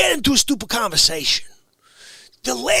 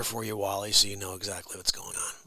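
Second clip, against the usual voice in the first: fast hushed whisper to Wally; 11 dB quieter, faster than usual. Real, not acted. Whispering the setup to Wally, fast and hushed. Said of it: "let me just paint the picture".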